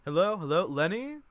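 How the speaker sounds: high, loud call into an empty house. Acted. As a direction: call out to find Lenny. Chick calling into the house.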